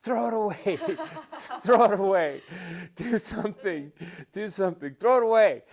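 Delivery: loud, high-pitched urgent shouts; imitated yelling. Dad yelling to his quarterback son mid-play, imitated shouting. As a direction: yell it like a frantic dad from the sideline. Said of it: "I can hear him yelling".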